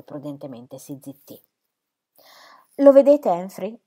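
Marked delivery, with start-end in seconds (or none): 2.8-3.8 loud, high burst at her husband; vindicated exclamation. A narrator's reading. Wife to her husband, waving her arm, triumphant exclamation. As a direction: exclaim it as proof you were right. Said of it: "esclamò missis Cadwallader agitando il braccio".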